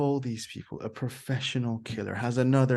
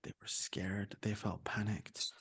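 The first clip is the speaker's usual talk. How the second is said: scared whisper; 5 semitones lower, 15 dB quieter than usual. Acted. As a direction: hush it like you're frightened. Teacher acts out fear.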